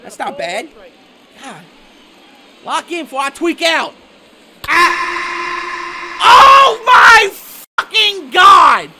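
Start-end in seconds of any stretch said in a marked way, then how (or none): 4.7-8.9 anguished yell, dragging out fucking God; real rage at the screen; furious shout. Real, not acted. Gamer raging at a bad play, anguished shouting.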